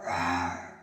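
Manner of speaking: deep, loud growling roar like a bear. Acted.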